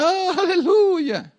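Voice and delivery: high-pitched, drawn-out mock hallelujah; put-on church cry, stretched and high; super-spiritual hallelujah. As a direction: parody an over-pious believer's swooping high praise. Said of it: "tem muita gente que fala".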